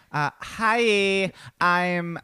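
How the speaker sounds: put-on high, bubbly Valley Girl voice. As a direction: play a ditzy Valley Girl. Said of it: "my best Valley Girl voice".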